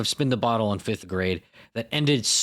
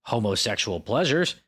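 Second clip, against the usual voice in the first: ordinary delivery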